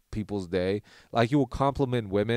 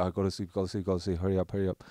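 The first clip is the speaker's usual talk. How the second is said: low, soft, hurried self-talk; hushed urging; 5 semitones lower, 6 dB quieter, much faster than usual. Retold or imitated. Telling himself to sleep before snoring resumes, hushed urging. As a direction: murmur urgently to yourself, low and soft.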